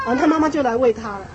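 usual speaking voice

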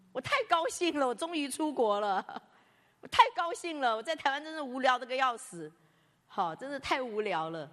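high and loud, delighted to leave; teen self crowing about escaping boring Taiwan; gleeful. Retold or imitated. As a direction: burst out with glee at finally going abroad.

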